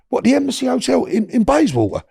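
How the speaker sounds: high-pitched, incredulous check; retold disbelief. Caller doubting the hotel she just named, incredulous. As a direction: act out astonishment, pitched well up.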